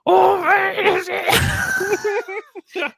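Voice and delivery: very high, slow squealing voice; a shrill hammed-up pig lady; Miss Piggy. Host badly attempting Miss Piggy, high and drawn out. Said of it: "I don't know how she talks".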